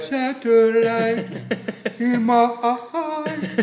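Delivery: very slow [name] croon; [name] impression. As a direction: stretch every word like [name]. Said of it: "how [name] talks".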